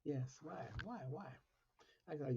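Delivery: ordinary delivery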